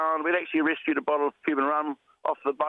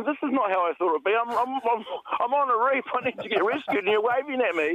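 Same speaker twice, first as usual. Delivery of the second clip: exasperated; 7 semitones higher than usual. Retold or imitated. Exasperated inner thought retold.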